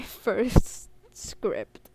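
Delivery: slow, laughing through the words. Real, not acted. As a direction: laugh it out, embarrassed. Laughs at her own mistake.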